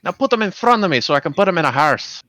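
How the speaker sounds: put-on Scottish accent. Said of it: "do a Scottish accent".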